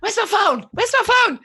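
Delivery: high, loud mock panic; frantic. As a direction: scramble for the phone in a hurry. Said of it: "I'll be like".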